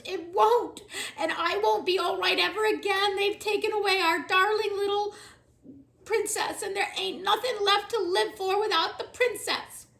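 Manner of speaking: loud, high sobbing wail. Acted. Cook wailing over the lost princess. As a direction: wail in despair.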